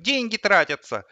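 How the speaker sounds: put-on high little-boy voice; child voice. A narrator's reading. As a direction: explain it as a small boy. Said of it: "Он говорил в телефон детским голосом". (детский голос)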